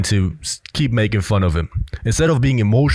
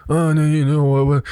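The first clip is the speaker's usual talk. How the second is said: higher-pitched mock stammering excuse; flustered self-explaining; 5 semitones higher than usual. Mocking how Tyler Durden would cringingly explain himself, flustered and higher. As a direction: imitate someone scrambling to explain himself. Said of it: "would start to explain himself".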